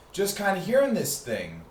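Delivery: normal talking voice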